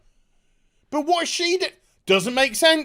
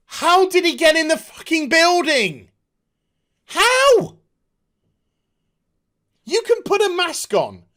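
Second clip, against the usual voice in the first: much higher, louder how; incredulous yell; 6 semitones higher, 5 dB louder than usual. Real, not acted. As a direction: shout the disbelief out. Ranting at plot holes.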